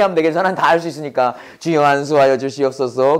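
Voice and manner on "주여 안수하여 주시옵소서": mock prayer. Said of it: "prayer quote".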